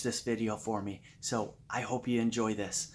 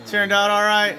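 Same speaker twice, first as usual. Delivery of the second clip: excited; 6 semitones higher, 10 dB louder than usual. Real, not acted. Host celebrating at party.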